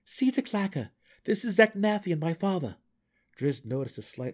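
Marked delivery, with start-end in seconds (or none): 0.0-2.8 high and loud, commanding; urgent shout. A narrator's reading. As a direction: send him off firmly. Drow shouting orders mid-battle.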